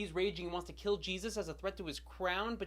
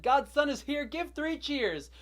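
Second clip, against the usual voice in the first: high, raised announcing voice; angelic proclamation; 7 semitones higher, 5 dB louder, slower than usual. Acted. An angel announces the birth to shepherds, high proclamation. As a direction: proclaim it high, stretching the final cheer.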